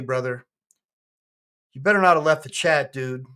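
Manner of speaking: ordinary delivery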